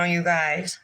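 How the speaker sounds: ordinary delivery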